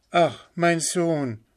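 high, slow lament; pleading mother. A narrator's reading. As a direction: call imploringly to a son. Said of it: "rief sie". (flehend klagende Mutter)

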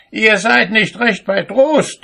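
high-pitched indignant rebuke; outraged call. A narrator's reading. Cobbler to farmer with overloaded wagon, high indignant shout. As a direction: cry out in high, scolding outrage. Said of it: "rief er".

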